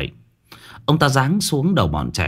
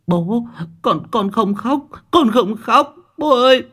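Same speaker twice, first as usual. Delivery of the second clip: put-on tearful little boy; 14 semitones higher than usual. A narrator's reading. Frightened boy insists to his father he isn't crying, tearful pleading. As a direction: plead upward like a child on the verge of wailing. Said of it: "Thằng bé mếu máo".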